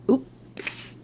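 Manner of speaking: breathy whispered oops; mock-guilty whisper, barely voiced; a tiny sheepish whisper. Retold reaction to breakage.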